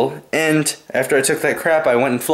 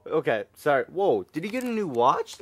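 ordinary delivery

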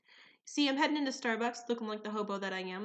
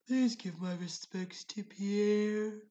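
very slow, mock-solemn condolence; bow out with exaggerated gravity; mock-solemn respects; much slower than usual. Leaving Starbucks, customer offers slow mock-solemn respects.

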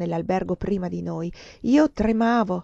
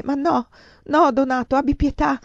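desperate tearful plea; 7 semitones higher, 4 dB louder than usual. A narrator's reading. Sobbing countess begging the lover for pity, desperate tearful plea. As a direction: beg for mercy in despair. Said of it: "Io singhiozzavo disperatamente".

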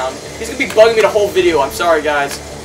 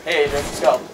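ordinary talk

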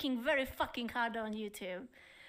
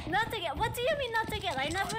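higher, louder outraged denial; indignant protest; 7 semitones higher, 4 dB louder, faster than usual. Real, not acted. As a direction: deny it, offended. Protesting accusation.

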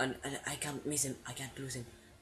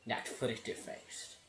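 mocking whisper; 4 dB quieter, slower than usual. Real, not acted. Mocks the cutscene line.

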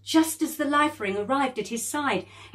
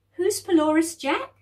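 put-on curious child; 9 semitones higher, 7 dB louder than usual. Girl asks curious question.

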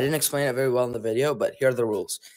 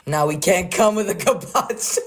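normal talking voice

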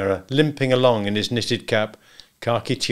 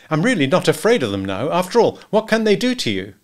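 higher, newly confident client; Macumber, eager; 8 semitones higher, 4 dB louder than usual. Acted. Husband declares he has lost his fear, eagerly. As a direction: brave excitement, rising higher.

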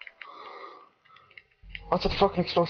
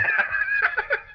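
chipmunk laugh. Real, not acted. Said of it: "dirty little chipmunk laugh".

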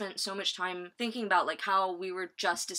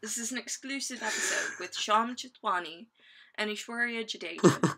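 American trying a British accent; attempted British accent. Shown as a demonstration. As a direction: put on an unconvincing British accent. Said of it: "this is my British accent".

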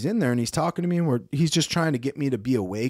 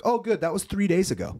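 offhand doctor; 7 semitones higher than usual. Retold or imitated. Voicing the casual doctor.

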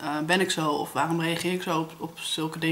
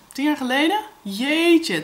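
higher and louder, disbelieving rise; surprised; 6 semitones higher, 4 dB louder than usual. Real, not acted. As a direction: realise with a jolt how long ago. Shocked at how long ago. (verbaasd, ongelovig)

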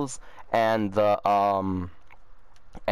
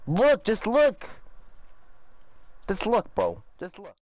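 high silly teasing voice; 7 semitones higher than usual. Pretend. Teasing friend over a screen.

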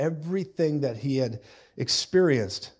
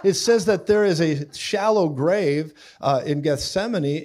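usual speaking voice

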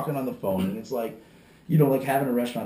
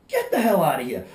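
reenacted kick-out; 6 semitones higher, 5 dB louder than usual. Reenacting throwing a customer out.

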